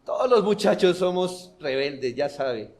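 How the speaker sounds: a hint of a careless teen making excuses; lightly voiced rebellious youth. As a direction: shrug it off like a young rebel. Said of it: "No solo decir". (imitando levemente a un muchacho rebelde)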